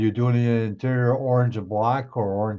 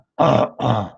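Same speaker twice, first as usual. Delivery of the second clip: throat clearing; 7 dB louder than usual. Real, not acted. Clearing throat mid-question.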